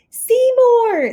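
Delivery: high loud scream of a name; panicked yell. Acted. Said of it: "Splat screamed".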